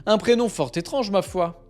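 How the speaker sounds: ordinary talk, nothing marked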